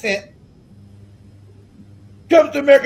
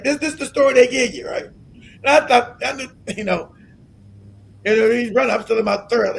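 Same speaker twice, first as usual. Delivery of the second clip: ordinary talk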